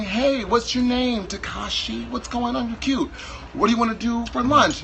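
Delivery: lightly voiced coy suitor asking about lunch; mock sweet date talk. Dating in Japan, a sweet date asks about lunch, light coy voice. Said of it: "like, saying".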